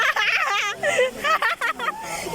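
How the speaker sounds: high squealing giggle; ticklish giggle. Real, not acted. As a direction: giggle from fish nibbling feet. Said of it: "Nakikiliti ako!". (tawang nakikiliti)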